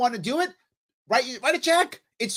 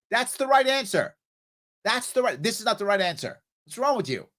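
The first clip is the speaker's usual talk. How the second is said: ordinary talk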